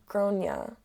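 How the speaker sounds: Gronia said GRAWN-ya; two-syllable Irish-style Gronia; typical Irish pronunciation. Shown as a demonstration. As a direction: say Gronia with stress up front and -ya to close. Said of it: "they typically pronounce it like".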